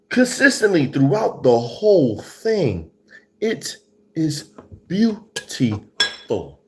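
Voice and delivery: high, slow, emphatic raving; ecstatic food rave. Real, not acted. Reviewer raves to viewers about the crisp back, ecstatic. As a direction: pitch it way up and punch each word slowly.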